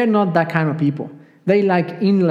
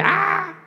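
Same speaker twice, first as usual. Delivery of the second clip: mock scream; 13 semitones higher than usual. Preacher imitating apostles seeing a ghost, mock scream. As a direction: imitate a frightened scream at restrained volume. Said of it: "And they screamed".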